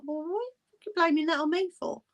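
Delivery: high-pitched retold indignation; acting out an indignant comeback; indignant protest. Retelling a squabble with friend.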